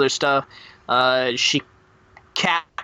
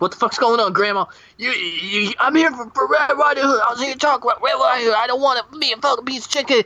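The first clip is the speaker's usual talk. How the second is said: panicked wolf; 8 semitones higher, faster than usual. Acted. Wolf panicking, grandma chases.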